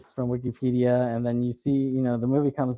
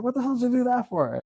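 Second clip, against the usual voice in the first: fast, very high incredulous imitation; mock disbelief, pitched way up; retold outrage; 12 semitones higher, faster than usual. Clerk reacting to the ranger's shooting, mock outrage.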